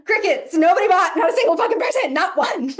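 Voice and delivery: loud and high in disbelief; exasperated outburst. Retold or imitated. Telling listeners nobody bought, a loud, high outburst. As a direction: belt out the letdown. Reveals the failed launch.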